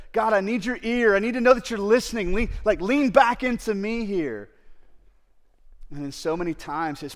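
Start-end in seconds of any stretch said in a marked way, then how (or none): 0.1-4.5 louder, higher, urgent plea; pleading with God. Retold or imitated. Voicing David begging God to lean close and listen, loud urgent plea. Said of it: "He's like".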